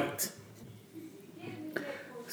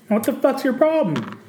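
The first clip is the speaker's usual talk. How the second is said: angry; 9 semitones higher than usual. Retold or imitated. Quarrel with wife in car.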